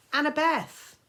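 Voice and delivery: loud, high call of her name. Acted. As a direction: snap her out of it with a shout. Percy shakes her, calls out.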